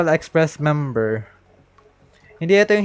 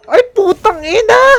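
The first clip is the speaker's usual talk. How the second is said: much higher and louder curse of dismay; real outburst of despair; anguished shout; 9 dB louder than usual. Real, not acted. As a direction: scream it at the screen. Reacts to losing the banner.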